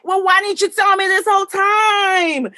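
high, loud shout of disbelief; a retold cry of why-nobody-told-me; exasperated disbelief. Retelling her shocked reaction.

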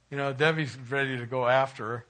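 normal talking voice

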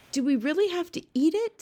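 dreading the food; little girl voice. Acted. Sophie pleading.

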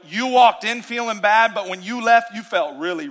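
usual speaking voice

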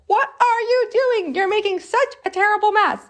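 high, scolding big-sister voice; bossy sister. Retold or imitated. Teacher voicing a bossy sister scolding her brother, high and scolding. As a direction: play the bossy big sister telling him off. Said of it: "she's very bossy".

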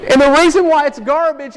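That's garbage called out in mock disgust; emphatic. Real, not acted. Preacher rejecting mom's advice.

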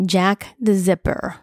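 name repeated slowly and deliberately. A narrator's reading. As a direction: draw out the name as you repeat it. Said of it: "I repeated".

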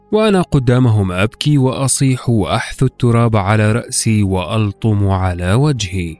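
plain reading voice

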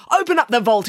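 demanding. Acted. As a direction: order the bank manager about forcefully. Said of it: "he demanded".

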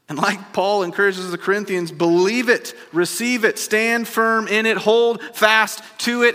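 pastor rallying the congregation, building up; building preaching. Real, not acted.

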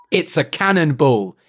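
normal talking voice

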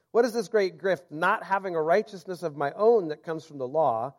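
ordinary talk, nothing marked

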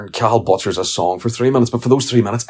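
ordinary talk, nothing marked

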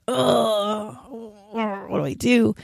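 soft, whiny half-cry of despair; frustrated whimper. Retold or imitated. Retelling her younger self's frustration over a guy, whimpering. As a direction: let a little sob into the complaint. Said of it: "I remember being kind of frustrated".

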